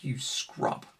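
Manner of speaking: soft, breathy jab under the breath; whispered insult. Retold or imitated. Muttering at the opponent who beat him, whispered insult. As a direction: whisper it so he can't hear.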